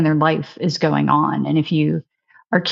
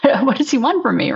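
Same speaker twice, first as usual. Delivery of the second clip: higher-pitched, half-laughing exasperation; frustrated; 7 semitones higher than usual. Retold or imitated. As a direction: retell bewildered frustration with a laugh. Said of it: "I would get really frustrated".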